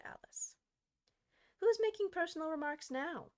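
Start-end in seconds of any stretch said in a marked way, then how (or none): 1.6-3.3 higher and a bit louder, gloating; a mildly voiced triumphant jab. A narrator's reading. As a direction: score the point over Alice. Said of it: "the Hatter asked triumphantly".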